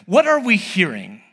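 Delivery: ordinary talk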